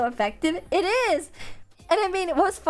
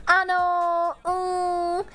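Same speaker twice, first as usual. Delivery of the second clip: hesitant filler; 3 semitones higher, much slower than usual. Retold or imitated. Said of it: "turn to Sister Martin and be like".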